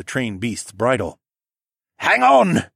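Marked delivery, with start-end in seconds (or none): 2.0-2.6 high loud holler over the roar; shouting over waterfall. A narrator's reading. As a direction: yell over rushing water. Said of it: "Siskern hollered over the roar of the waterfall".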